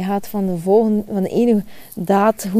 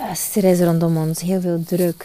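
ordinary delivery

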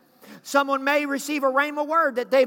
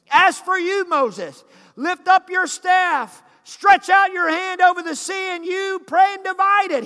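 commanding voice of God; 6 semitones higher, 6 dB louder, slower than usual. Retold or imitated. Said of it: "somebody needs to catch this".

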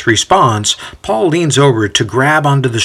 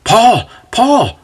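high, excited calling out; enthusiastic; 11 semitones higher than usual. A narrator's reading. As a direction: call the name eagerly, pitched well up. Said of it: "Timothy exclaims with enthusiasm".

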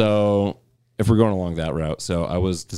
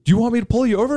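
much higher, louder mock threat; threatening cop; 4 dB louder, much faster than usual. Cop threatening the driver, hammed-up angry. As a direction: threaten like a hot-headed cop.

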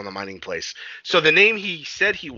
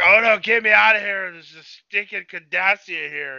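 loud, high-pitched mock protest; retold drunken complaint, raised voice; drunk Bajoran; 7 semitones higher, 6 dB louder than usual. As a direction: holler it like a locked-up drunk, then drag it out. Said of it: "drunk in the promenade".

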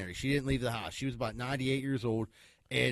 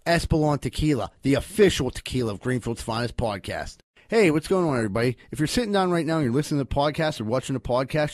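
brisk podcast ad read; sponsor ad read; 9 dB louder than usual. Acted. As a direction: read the sponsor copy with punch. Reads sponsor ads for the podcast.

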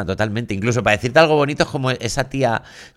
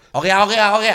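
high loud Ogia calls; crazy Ogia; 11 semitones higher, 4 dB louder than usual. Retold or imitated. Showing how you say it at the bar, high loud Ogia. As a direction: call Ogia high and loud like someone unhinged. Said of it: "lo dices como".

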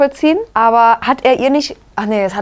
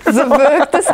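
laughing; 4 semitones higher than usual. Real, not acted. Lacht über nackte Szene.

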